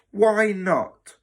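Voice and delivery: loud, high-pitched challenge; sharp demand. Acted. Matron interrogating the new boy about his parents, demanding. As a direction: demand an answer imperiously.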